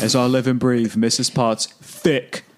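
slow, drawn-out Cockney voice; Cockney accent. Acted. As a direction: lay on a thick Cockney accent. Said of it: "saying that with a Cockney accent".

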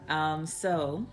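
normal talking voice

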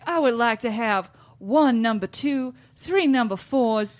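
slow, drawn-out Southern belle ordering; imitated Southern accent. Mom ordering for the kids at drive-thru, imitated Southern accent. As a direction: stretch it into a mock Southern drawl. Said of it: "ordering in this Southern accent".

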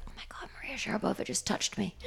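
breathless whisper in disbelief; excited secret whisper; starstruck whisper. Retold or imitated.

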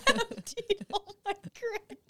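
high, slow, laughing through it. Real, not acted. Repeating the joke to friends while cracking up. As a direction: barely get it out for laughing.

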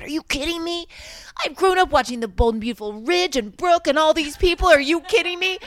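high, loud excited outburst; acted disbelief. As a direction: re-enact being thrilled and incredulous. Re-enacting her amazed reaction.

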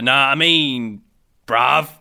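slow, drawn-out Cockney bruv; a lazy, teasing Cockney sign-off; Cockney accent. Acted. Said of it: "Maybe I should start talking Manchester".

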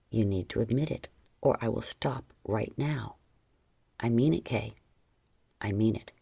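deep firm warning; low male warning; low stern man. A narrator's reading. Lover threatening to stop.

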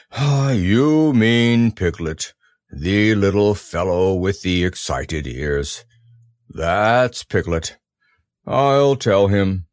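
slow, low gloomy donkey; Eeyore voice. Acted. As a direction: drag it out mournfully. Eeyore speaking.

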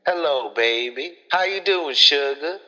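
lightly voiced sweet Southern auntie, high on doing; sweet Southern lady. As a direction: coo it like a sweet older auntie, a hint only. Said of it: "and she's like".

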